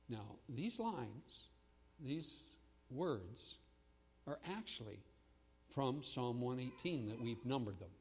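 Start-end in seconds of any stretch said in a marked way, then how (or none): none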